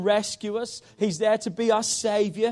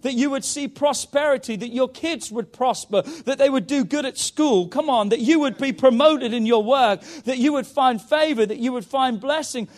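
rising, louder preaching drive that builds; full-force preaching; 2 semitones higher, 5 dB louder than usual. Acted. Preacher to the church, promising blessing, in full-force preaching. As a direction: pour it out on the congregation like a revival.

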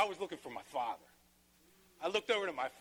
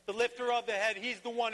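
raised, higher preaching voice building to a climax; preaching at full force; 9 semitones higher, 5 dB louder than usual. Acted. Pastor rousing the congregation about God's promise, full-force preaching. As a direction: lift the room, preach it out loud and high.

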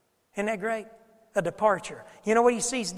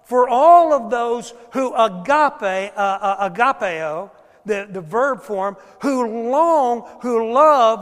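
preaching; 3 semitones higher, 10 dB louder, slower than usual. Acted. Loud scripture preaching.

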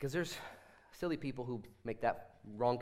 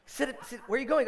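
calling son back; 11 semitones higher, 7 dB louder than usual. Retold or imitated. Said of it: "I said".

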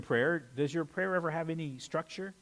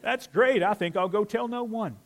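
high, loud mock cheer; sarcastic fake enthusiasm; high loud sarcastic delight; 6 semitones higher, 7 dB louder than usual. Sarcastic mock reaction to keeping news.

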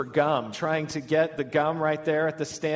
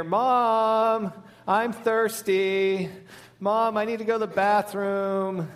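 put-on higher, drawn-out kid calling for mom; whining child; 5 semitones higher than usual. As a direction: whine it like a child stalling at bedtime.

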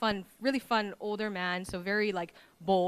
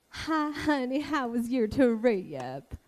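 slow sweet Southern lady, syllables stretched; higher, slow, honeyed Southern greeting; slow Southern drawl; 4 semitones higher, 3 dB louder, much slower than usual. Retold or imitated. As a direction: draw out every word like a Southern housemother. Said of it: "because she was talking slow".

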